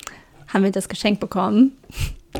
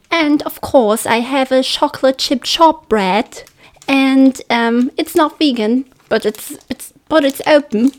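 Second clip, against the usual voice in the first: higher, put-on British accent; 4 semitones higher than usual. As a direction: keep up the British gift-giver voice. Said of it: "britischer Akzent".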